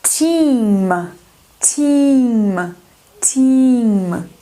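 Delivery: "team" with a long drawn I; teacher modelling "team" for students, long I. Shown as a demonstration. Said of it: "E com A".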